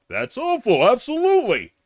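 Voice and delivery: mock action-star debater voice; Stallone. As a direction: imitate a clueless boxer agreeing in a debate. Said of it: "Here's my impression of Stallone".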